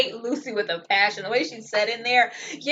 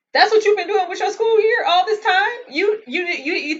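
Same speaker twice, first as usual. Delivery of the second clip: higher, louder, incredulous scold; exasperated outburst; 8 semitones higher, 6 dB louder than usual. Real, not acted. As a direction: let the disbelief boil over.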